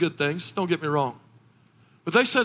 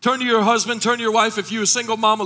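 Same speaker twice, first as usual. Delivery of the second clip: normal talking voice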